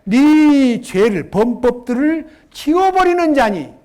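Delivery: each word drawn out, loud and weighty; solemn proclamation; slow emphatic scripture reading. Acted. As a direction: proclaim it like holy writ.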